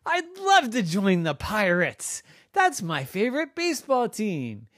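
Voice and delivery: high, eager cheer; excited baseball players. Acted. Baseball players thrilled to join the Pirates, high and eager. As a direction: gush like a thrilled fan. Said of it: "And they all said".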